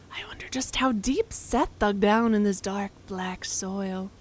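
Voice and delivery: slow, whispery character voice; game character. Acted. Reader voicing a character at the dug hole, slow whisper. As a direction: wonder aloud in a slow whisper.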